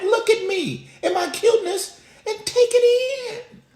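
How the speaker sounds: put-on very high squeaky baby voice; toddler son. Toddler telling dad to admire his cuteness, high mock baby voice. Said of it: "I know he's like".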